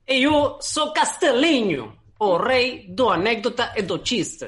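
slow, high mock Portuguese; Brazilian accent. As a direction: ham up a Brazilian showman. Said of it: "Soy [name]". (acento brasileño exagerado)

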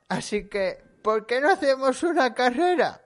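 very high, loud scheming wolf; hammed-up sly cartoon wolf. Wolf proposes a race.

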